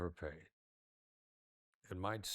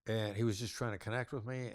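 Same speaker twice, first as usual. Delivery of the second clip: ordinary delivery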